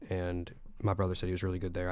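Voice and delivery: ordinary talk, nothing marked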